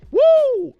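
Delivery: sudden high, loud woo; mock cheer. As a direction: let out a hammed-up high whoop of joy. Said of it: "I was like".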